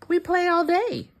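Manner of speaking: bright quick high play line; playful high. Acted. Reader to child, high quick playful.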